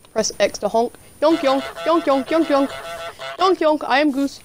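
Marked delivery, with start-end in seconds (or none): 1.2-2.7 goose honking. Pretend. 3.4-4.4 silly goose voice. Pretend. Roleplaying as the goose.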